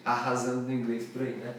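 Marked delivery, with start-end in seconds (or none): none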